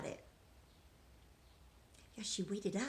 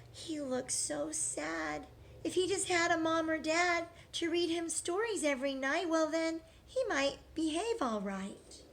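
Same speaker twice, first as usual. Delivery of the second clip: pitying Eliza; 6 semitones higher, 3 dB louder than usual. Acted.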